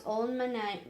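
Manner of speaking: ordinary delivery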